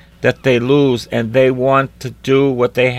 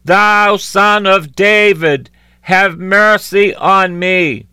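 high, slow cry that strains into a breathy end; crying out louder; 8 semitones higher, slower than usual. A narrator's reading. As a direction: cry out, then run out of breath on the plea. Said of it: "he cried the more".

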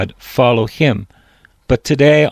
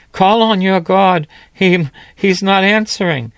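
higher, scornful jab; mocking taunt; 7 semitones higher than usual. Elijah mocking the prophets whose god stays silent, higher taunt. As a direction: needle them, voice pitched up. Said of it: "Elijah says".

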